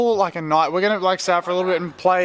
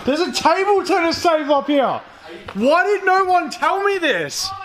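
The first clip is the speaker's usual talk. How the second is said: excited shout; 9 semitones higher than usual. Real, not acted. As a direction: shout it out in delighted surprise. Finding a surprise ping pong table.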